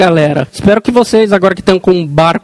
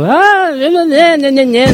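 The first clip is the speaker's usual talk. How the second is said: mocking; 7 semitones higher, slower than usual. Mocking others teasing each other.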